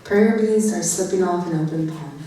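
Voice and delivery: loud, projected poem recital; poetry reading. Acted. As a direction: declaim the lines out to the room. Poet begins reading a poem.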